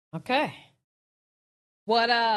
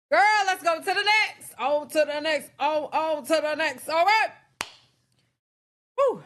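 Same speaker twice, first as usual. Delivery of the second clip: high and loud, hyping the next topic; excited moving on; 4 semitones higher, 4 dB louder than usual. Real, not acted. Host hyping viewers toward the next story, loud and high. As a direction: bounce onto the next story, raised.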